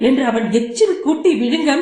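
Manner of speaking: ordinary delivery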